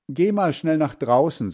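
narrated grandmother giving an order; urging grandmother. A narrator's reading. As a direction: send the child off briskly. Grandmother sends boy out.

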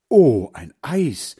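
slow, high, loud "oh" of delight; delighted exclamation. A narrator's reading. Boys spotting the ice-cream cart, exclaiming with delight. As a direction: exclaim longingly at a sudden treat.

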